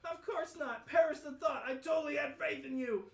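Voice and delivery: loud flustered protest. Acted. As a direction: protest way too much. Acted flustered denial.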